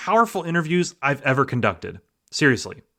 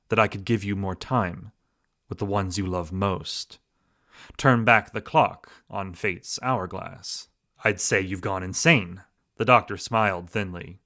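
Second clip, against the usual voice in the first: story reading; 7 semitones lower than usual. Acted. Reads story excerpt aloud.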